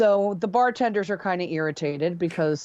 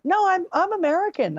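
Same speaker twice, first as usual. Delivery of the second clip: high insistent protest; 12 semitones higher than usual. Retold or imitated. Singer answers in the story.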